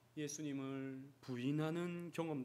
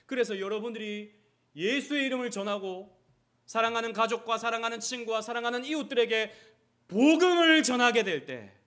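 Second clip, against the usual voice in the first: rising, louder and higher to a ringing peak; preaching at full force; 8 semitones higher, 14 dB louder than usual. Acted. Pastor urging the church to share the gospel, full-force preaching. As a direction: preach it out to the whole congregation.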